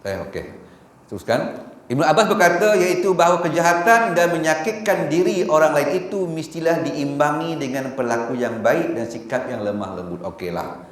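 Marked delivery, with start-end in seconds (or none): 1.9-10.2 raised, louder reading voice; formal recitation. Acted. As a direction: read the quote out like a proclamation. Reads Ibn Abbas quote aloud.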